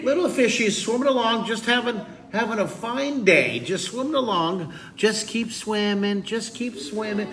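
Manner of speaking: high, lilting little fish voice; carefree little fish. Pretend. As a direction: chirp along as an oblivious little fish. Voices carefree fish swimming along.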